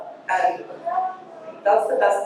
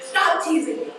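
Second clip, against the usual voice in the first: higher-pitched mock protest; teased sister's protest; 8 semitones higher than usual. Speaker recalls telling her sister off, mock complaining. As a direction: imitate your younger self protesting the teasing. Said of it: "and I'd be like".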